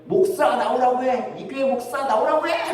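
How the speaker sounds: high-pitched imitation of a belligerent drunk; demanding drunkard. Drunk pounding on the church door for the pastor, belligerent. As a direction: act out a drunk calling the pastor out. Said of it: "술주정꾼이 와가지고 교회 문을 막 두둥기면서".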